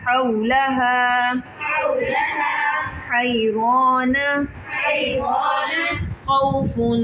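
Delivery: slow recited words; reading without spelling. Shown as a demonstration. Teacher reading joined words slowly for the girls. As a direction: chant each word out slowly like a Qur'an teacher. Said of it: "हिचे के बगैर पढ़ेंगे".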